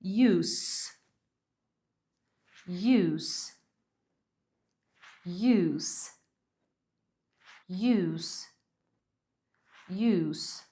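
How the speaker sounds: repeat-after-me "use", S ending; isolated "use" with unvoiced S. Shown as a demonstration. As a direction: hiss the final S of "use". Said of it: "sonzinho de S no final".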